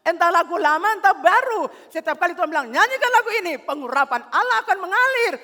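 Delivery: loud, high, fired-up preaching; preaching at full force. Acted. Pastor preaching loudly to the congregation about worship.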